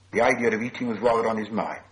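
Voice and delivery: plain reading voice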